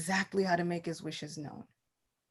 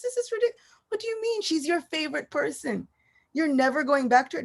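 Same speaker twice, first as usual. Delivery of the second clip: incredulous father; 6 semitones higher, faster than usual. Acted. Father scolds son in disbelief.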